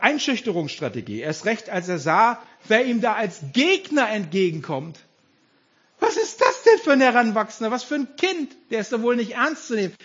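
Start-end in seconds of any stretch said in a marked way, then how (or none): 6.0-9.9 high, scoffing disbelief, loud at first; Goliath's mockery. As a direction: scoff incredulously, pitch raised. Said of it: "Goliath macht sich total lustig".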